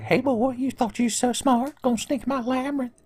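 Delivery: very high, put-on taunting spook; spooky voice. Labyrinth voice taunts the sneaking boy, high and spooky. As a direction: stretch it out in a high, silly ghost voice. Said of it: "the spooky voice found us".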